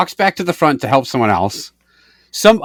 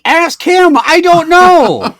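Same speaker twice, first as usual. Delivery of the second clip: exasperated retort; 13 semitones higher, 5 dB louder, much slower than usual. Retold or imitated. Exasperated at worker's question.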